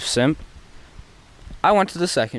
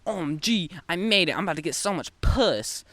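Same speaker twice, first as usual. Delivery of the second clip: higher-pitched mock triumph; excited inner voice; 6 semitones higher than usual. Boy thinking he made it with his crush, mock excited. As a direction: imitate your own giddy thought. Acted excited thought in the story.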